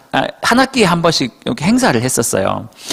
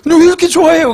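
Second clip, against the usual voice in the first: higher, louder, teasing surprise; puzzled question; 5 dB louder, faster than usual. Retold or imitated. Pastor puzzled by the sister's joy, asking with surprise. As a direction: ask why she's so thrilled.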